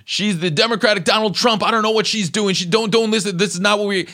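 high-pitched flustered apology voice; imitating union president. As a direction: squeak it up like a panicked guy saying sorry. Union president blaming her on phone.

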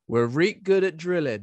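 mock Yorkshire drillers' boast, lightly done; a hint of broad Yorkshire, cheerful; Yorkshire accent. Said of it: "but they say something like".

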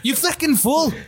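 high mock-Irish outburst; Irish accent. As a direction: exclaim it in a put-on Irish accent. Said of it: "you will do an Irish accent".

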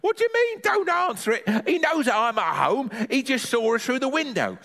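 put-on high, scolding old granny; granny exasperated at the boy's silly idea; Granny's indignant voice.

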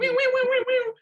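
high siren wail done with the voice; imitated police siren. Police put lights on.

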